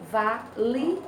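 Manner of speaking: "vali-o" slowly split into va, li, o; slow "vali-o", li and o kept apart; syllable by syllable. Shown as a demonstration. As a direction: pause between each syllable of "vali-o". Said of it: "O li separa do o".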